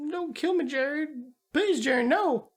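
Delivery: high-pitched imitation of a begging hostage; mock pleading. Captive therapist begs Jerry, mock pleading. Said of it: "She's like".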